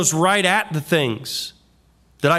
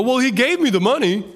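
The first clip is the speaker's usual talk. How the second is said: mock excuse; 8 semitones higher than usual. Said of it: "the cops come to you and go".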